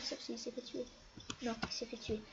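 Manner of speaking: unvoiced whisper aside; hushed whispered aside. Real, not acted. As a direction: whisper it to yourself. Aside about the hider.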